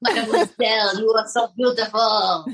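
lightly French, swooning; a hint of a French lover; flirting French charmer. Puppet flirts.

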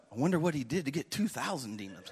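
low, soft wondering aside; inner thought. Acted. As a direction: think it to yourself, low and amused. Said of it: "My personal mind is going".

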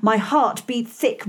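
ordinary delivery

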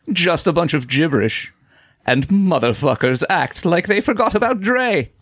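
high, hammed-up dramatic poetry recital; poetic voice. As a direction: declaim it like lofty verse. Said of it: "read this in my poetic voice".

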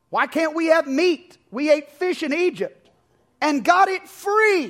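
high, loud whining complaint; whiny Israelites. Acted. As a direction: whine it like a spoiled crowd.

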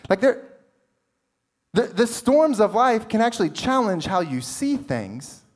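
usual speaking voice

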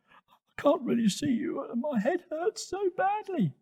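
high, plaintive little voice; small youngster complaining of pain; hurt little Toto. Acted.

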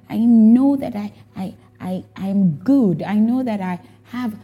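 distressed plea to God. Retold or imitated. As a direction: insist on your worth through the hurt. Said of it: "I was like".

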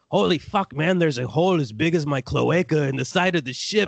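high, strained goat voice for a panicked lizard bounty hunter. Acted. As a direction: squeal it up high like the goat when the ship gets hit. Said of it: "I'm doing that voice as the Trandition bounty hunter".

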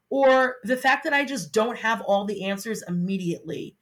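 ordinary delivery

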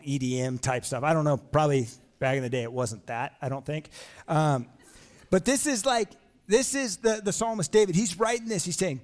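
normal talking voice

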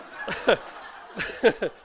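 breathy embarrassed laugh; real sheepish laugh; awkward laugh. Real, not acted. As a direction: laugh at the joke that fell flat. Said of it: "sorry, just flattened the whole room".

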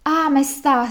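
lightly acted supplication; pleading. A narrator's reading. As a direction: beg the king for a hearing. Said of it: "supplicò il servo".

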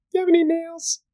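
very high little duck voice. Acted. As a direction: pipe it up high like a tiny duck. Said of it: "walks up to the bartender".

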